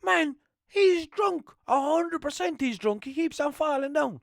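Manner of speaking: high, loud, slow exclaiming; hammed-up version of himself as his mate tells it; mocking his own drunk voice. Mate's version of him at the fight, mock high exclaiming. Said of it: "I turned around to him and goes".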